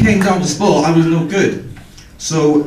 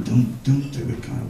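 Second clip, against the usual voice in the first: inner warning voice; 4 semitones lower, 8 dB quieter than usual. Retold or imitated. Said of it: "My brain saying".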